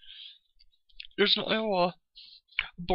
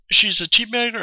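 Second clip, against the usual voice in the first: angry jab; 5 semitones higher, 6 dB louder than usual. Real, not acted. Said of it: "I'm just pissed off".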